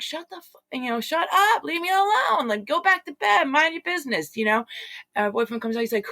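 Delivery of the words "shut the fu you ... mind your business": high, strained yell at the dark; a high, frantic yell, retold. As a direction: yell at the unseen neighbors to shut up. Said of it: "Who are you yelling at?".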